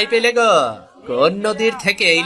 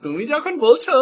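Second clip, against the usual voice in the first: put-on young son voice; 8 semitones higher than usual. Son answers his father.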